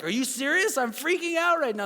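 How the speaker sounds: high, loud, incredulous outburst; acted freak-out. Dad in the hospital waiting room to friends, retold freak-out. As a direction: replay your own panic on the phone. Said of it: "am the one freaking out".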